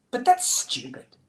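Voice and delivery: high, slow indignant protest; hammed-up childish outrage; hopping mad. A narrator's reading. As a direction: protest like a cross little child. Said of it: "she's hopping mad".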